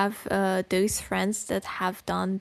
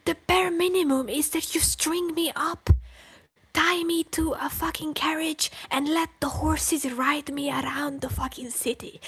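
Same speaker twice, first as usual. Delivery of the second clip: high, intense rant turning to whisper; 1 heated rant; 7 semitones higher than usual. Real, not acted. Streamer ranting about what friends should do. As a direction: build it up into a wild exaggeration.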